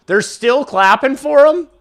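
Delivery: high, loud thrilled disbelief; can't believe what he's seeing; excited. Real, not acted. Streamer spotting fans in jerseys on the broadcast, thrilled.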